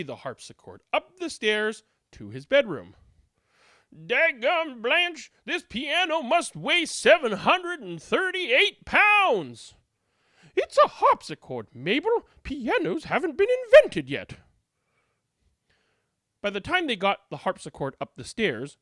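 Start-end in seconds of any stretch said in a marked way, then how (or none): 3.9-9.7 high, slow, groaning complaint; straining maid. Acted. Maid hauling the harpsichord upstairs, high slow groan. 10.6-14.4 high, louder know-it-all reply; correcting maid. Acted. Second maid corrects Mabel, high pert voice.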